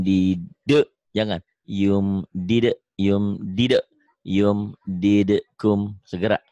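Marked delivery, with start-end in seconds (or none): none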